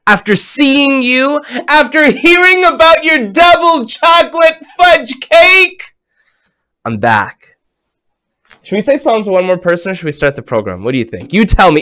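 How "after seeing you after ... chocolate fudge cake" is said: excited. Real, not acted. Host to child, gushing about her fudge cake, excited. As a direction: voice climbing high and louder with delight.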